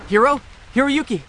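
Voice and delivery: anxious, high-pitched call into the house. Acted. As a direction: call to see if your friend is home. Calls out for friend at home.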